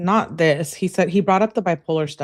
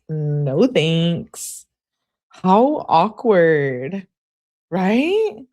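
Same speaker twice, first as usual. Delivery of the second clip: exaggerated cringing; 3 dB louder, much slower than usual. Real, not acted. Streamer reacting to unwanted girlfriend, drawn-out cringe. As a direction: stretch each word in awkward disbelief.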